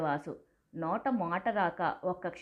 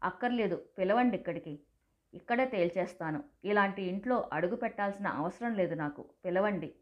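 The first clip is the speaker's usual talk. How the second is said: usual speaking voice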